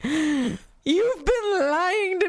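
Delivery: high, slow wailing with crocodile tears; hammed-up heartbroken wail; fake crying. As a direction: overplay the betrayal like a child. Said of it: "crocodile tears".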